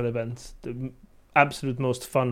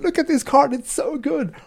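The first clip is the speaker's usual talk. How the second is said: put-on delighted kid voice; 11 semitones higher, 6 dB louder than usual. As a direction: gush like a thrilled child over a new card. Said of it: "He was just like".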